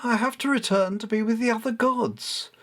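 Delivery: high, imperious goddess voice; goddess Bastet, commanding. Acted. Goddess Bastet speaking to boy.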